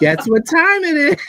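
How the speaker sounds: high, loud singsong tease; Thing voice teasing. Pretend. Teasing friends as the Thing, high and loud. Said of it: "the Thing".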